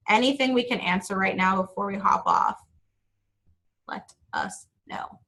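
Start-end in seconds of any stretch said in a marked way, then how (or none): 3.9-5.2 barely any voice left, whispered; losing voice. Real, not acted. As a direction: voice giving out to a whisper. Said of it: "I think I'm losing my voice too".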